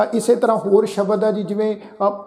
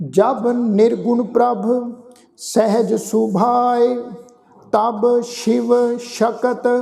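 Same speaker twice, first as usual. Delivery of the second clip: slow, measured chanting of the verse; scripture recitation; 3 semitones higher, much slower than usual. Shown as a demonstration. Sikh teacher recites Gurbani for the congregation, slow and measured. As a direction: recite each phrase slowly and reverently.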